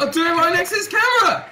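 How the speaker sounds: high, loud defiant yell; loud, high angry retort; heated shout. Real, not acted. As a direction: shout back at your teammate. Heated back-and-forth with teammate.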